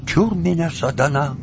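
высоко, с опаской, заклинает чёрта; писарь шепчет заговор от нечисти; испуганный писарь. Acted. Писарь у двери. (испуганно, с опаской)

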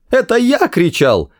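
high exasperated yell; shouting. Acted. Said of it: "закричал Артур".

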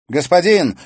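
high loud call. A narrator's reading. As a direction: call out to the master. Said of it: "воззвала юная ведьма". (громкий призыв)

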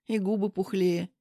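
usual speaking voice

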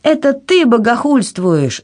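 high-pitched indignant outburst; mild cry. A narrator's reading. Anna accusing the king back, mildly shouted. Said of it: "закричала Анна".

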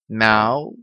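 imitated little meow, rising at the end; cat meow. A narrator's reading. Narrator voices a small cat.

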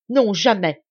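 frightening outburst. A narrator's reading. Lacheneur refuses to give up the château, in a frightening outburst. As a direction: explode in a furious refusal. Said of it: "avec un emportement effrayant".

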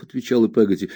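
plain reading voice

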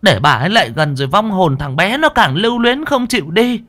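put-on high-pitched, stern old woman's orders; sharp rebuke, rising to a forceful ban; scolding shout. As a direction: snap orders at the mourners. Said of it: "Bà cụ Đoành quát".

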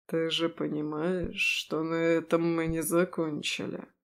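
low rumbling man's voice. Acted. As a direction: let it rumble low and promising. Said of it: "Ракочущие нотки в голосе".

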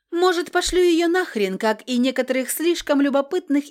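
high, loud, angry comeback; irritated retort. A narrator's reading. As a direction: throw it back loudly at a meddling friend. Angry retort to meddling friend.

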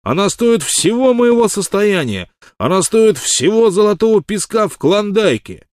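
high, loud rhapsodic declaration; impassioned. A narrator's reading. Wild Water leaps up praising Miss Arle, impassioned. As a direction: proclaim her worth to the skies. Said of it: "вскочил в пылу красноречия".

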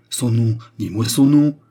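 cri pressant, plus fort; s'écriant. A narrator's reading. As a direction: exige le nom en criant. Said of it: "s'écria le marquis". (le marquis exige le nom du rival, cri pressant)

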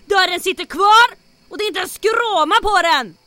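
building from loud to a high furious shout; mounting rage at the undamaged safe door, ending in a shout; rising fury. Acted. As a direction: let the anger swell until it bursts.

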